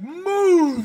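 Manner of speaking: very loud, high drawn-out bellow; megaphone-style yell. Retold or imitated. Imitating staff with a megaphone at a kid, mock bellowing. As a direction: blast it down at a kid's head. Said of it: "like, megaphones and".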